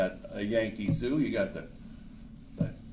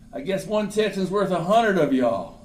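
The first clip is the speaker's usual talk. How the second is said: high and loud, crowing from the hilltop; cocky shout; 7 semitones higher, 6 dB louder than usual. Acted. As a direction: taunt the enemy below. Texan calls down from hill.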